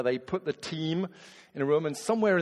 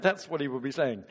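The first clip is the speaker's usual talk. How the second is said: ordinary delivery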